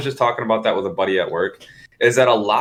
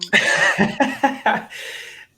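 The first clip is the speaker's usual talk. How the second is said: high, wheezing burst of laughter; hearty laugh; 13 semitones higher than usual. Real, not acted. Told they're now eligible for Brazil, hearty laughter.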